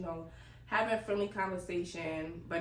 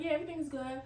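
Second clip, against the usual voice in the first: higher-pitched chirp as the technician; technician's reassurance; 9 semitones higher, much faster than usual. Retold or imitated. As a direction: lightly imitate an upbeat assistant. Quoting technician's breezy reassurance.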